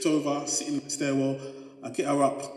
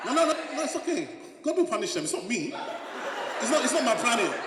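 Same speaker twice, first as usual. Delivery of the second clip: high, laughing protest; high playful laughing reassurance; 9 semitones higher than usual. Real, not acted. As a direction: brush it off with a giggle. Laughing off the mispronunciation.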